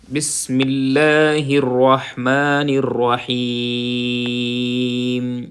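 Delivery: slow, drawn-out tajweed recitation; Quranic recitation. Shown as a demonstration. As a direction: chant each word slowly. (ٹھہر ٹھہر کر تلاوت)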